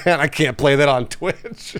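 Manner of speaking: ordinary talk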